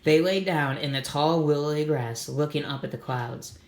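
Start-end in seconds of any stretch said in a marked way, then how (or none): none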